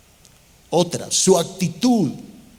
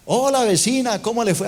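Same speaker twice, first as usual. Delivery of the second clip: cheery neighbour greeting; 5 semitones higher than usual. Pretend.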